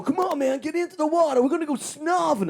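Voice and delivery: high-pitched, eager goading mimicry; hammed-up keen pal; Des Bishop impression. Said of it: "And he was going".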